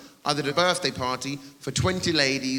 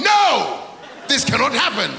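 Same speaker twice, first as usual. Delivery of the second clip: high, loud protesting cry; mock outrage; 9 semitones higher, 6 dB louder than usual. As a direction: cry out as an outraged crowd would. Imagined crowd protesting a festival.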